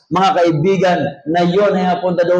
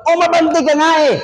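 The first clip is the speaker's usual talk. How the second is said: much higher hammed-up call out; mock call; 12 semitones higher, faster than usual. Preacher imitating people yelling to the jeep to move forward. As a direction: call to the jeep driver in a shrill mock yell. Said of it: "Sabi ng mga tao".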